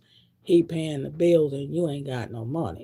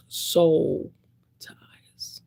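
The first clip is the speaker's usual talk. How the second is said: whisper. Real, not acted. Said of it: "whispered for effect".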